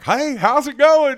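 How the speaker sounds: high, slow and loud hammed-up friendly hello; folksy country greeting. Friend greets New York dealmakers, mock-folksy high slow hello. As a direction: play the harmless good old boy walking into the meeting. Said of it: "he'd come in there like".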